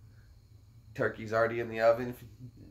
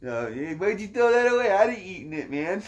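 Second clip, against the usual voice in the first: stoner voice; 9 semitones higher, 4 dB louder than usual. Retold or imitated. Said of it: "Chong would be this guy".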